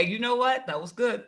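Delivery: usual speaking voice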